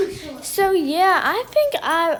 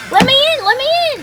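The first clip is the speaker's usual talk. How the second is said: high, louder insistent plea; urgent pleading; 11 semitones higher, 3 dB louder, faster than usual. Real, not acted. Locked out, begging Jack through the door, urgent pleading.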